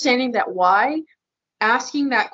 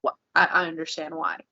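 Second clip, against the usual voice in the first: low, soft, patient reassurance; so calm; 5 semitones lower, 5 dB quieter than usual. Retold or imitated. Calm husband answering his angry wife, gently understanding. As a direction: imitate a calm, understanding husband, lower and quieter. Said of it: "was so calm. And he said".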